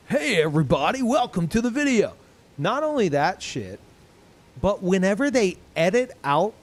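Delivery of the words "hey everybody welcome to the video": lightly voiced forced-cheery YouTuber greeting, going higher; mock YouTuber intro. Streamer mocks YouTubers' forced intro, light hype voice. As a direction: imitate a hyped YouTuber opening a video. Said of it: "the super forced high energy".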